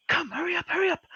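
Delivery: put-on very high old-folks voice urging haste; grandparents urging. Grandparents hurrying the player into the cabin, high urgent. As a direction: squeak out a frantic hurry up. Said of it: "they're kinda like".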